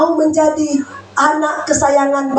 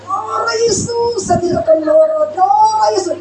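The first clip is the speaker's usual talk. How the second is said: strained self-restraint; 9 semitones higher than usual. Retold or imitated. Wife praying herself calm mid-quarrel, high and strained. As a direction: hold the anger down with a prayer.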